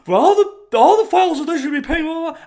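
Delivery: high, loud, fast sneering parody laced with laughs; mocking, high-pitched impression of the anchor; mocking the reporter. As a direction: ridicule her by squeaking it out. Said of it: "For her to say".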